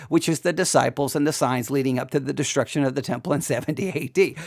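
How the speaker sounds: ordinary talk, nothing marked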